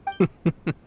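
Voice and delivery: short, high chuckle; light chuckle. Acted. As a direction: chuckle away the worry. Amused by the worried question.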